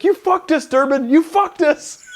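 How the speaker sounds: high-pitched mock shriek of a furious sergeant; imitating a yelling staff sergeant. Staff sergeant going off at a marine over a fake certificate, high mock shriek. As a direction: squeal it like a panicked boss losing it. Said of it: "he's like".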